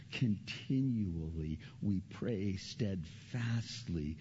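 slow it down reverently; solemn slow close. Real, not acted. Preacher closing on prayer, slow and solemn.

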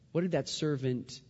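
ordinary delivery

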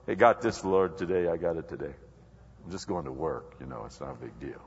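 low, soft and offhand, brushing God aside; carefree self-reliant believer. Retold or imitated. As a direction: mutter it like you've got it all handled. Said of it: "you're probably going".

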